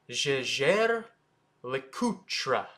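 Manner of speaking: Jaeger LeCoultre as zheh-zhair luh-koo-truh; French pronunciation. Shown as a demonstration. Teacher models the hardest name, French pronunciation. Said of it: "the way to actually say it".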